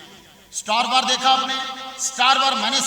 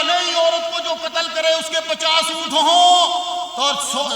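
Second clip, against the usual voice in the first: mocking the cleric; 6 semitones higher, 6 dB louder, slower than usual. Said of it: "جس مولوی نے کھڑے ہو کے کہا".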